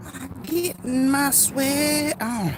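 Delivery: high, drawn-out silly voice; playful high drawl. Pretend. Streamer joking about working up a sweat, playful high drawl. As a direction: stretch it out slowly, way up high. Playfully repeating his own line.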